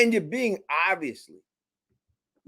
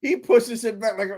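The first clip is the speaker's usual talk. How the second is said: ordinary delivery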